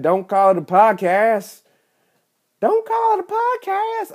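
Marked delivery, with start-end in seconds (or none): 2.6-4.1 high jingle voice. Acted. Show title as a jingle.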